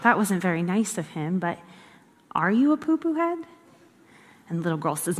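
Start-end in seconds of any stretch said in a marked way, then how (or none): 0.0-3.4 soft, lightly voiced mother; gently voiced mom, ending on a question; concerned mom. Mom answers her upset daughter, soft motherly imitation. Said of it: "And the mom goes".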